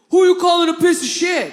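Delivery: loud, high mock-furious shout; furious challenge. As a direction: imitate an enraged driver turning back. Driver turns back, fists clenched.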